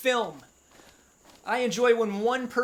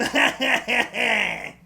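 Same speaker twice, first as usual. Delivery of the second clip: loud laugh; 4 dB louder than usual. Real, not acted. Cracks up after the voice.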